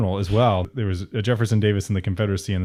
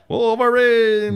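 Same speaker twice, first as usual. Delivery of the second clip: high, loud, drawn-out shout; long high holler; movie battle cry; 14 semitones higher, 6 dB louder, much slower than usual. Acted. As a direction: belt it out like a rallying cry.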